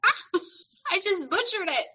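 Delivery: high-pitched mock horror with a laugh; dismayed outburst. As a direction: wail over your own blunder. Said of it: "And I'm like".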